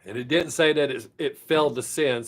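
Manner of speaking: ordinary talk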